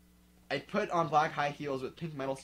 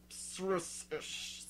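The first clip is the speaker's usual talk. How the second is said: ordinary talk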